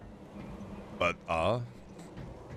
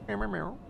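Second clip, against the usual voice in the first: mock meowing; 9 semitones higher, 8 dB quieter than usual. Imitating cat.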